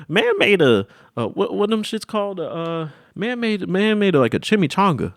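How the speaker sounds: high-pitched disbelief; amazed. Real, not acted. Storyteller marvelling at the chef, high and amazed.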